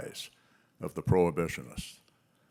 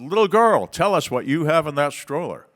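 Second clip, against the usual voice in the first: put-on higher, louder showman call to the girl; showy billionaire; 7 semitones higher, 6 dB louder than usual. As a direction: play it up for the cameras, grand and loud. Billionaire performs for press cameras.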